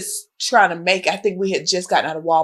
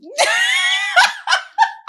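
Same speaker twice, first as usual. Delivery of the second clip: high shrieking laugh. Real, not acted. Pregnant host picturing herself climbing out, shrieking laugh.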